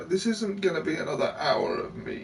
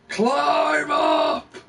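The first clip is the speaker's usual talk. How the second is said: shouting; 9 semitones higher, 5 dB louder than usual. Real, not acted. Shouting at game character.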